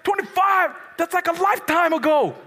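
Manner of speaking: high, loud disbelieving outburst; incredulous teen. Retold or imitated. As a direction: balk at how far off it is. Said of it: "I was like".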